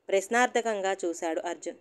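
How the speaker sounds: plain reading voice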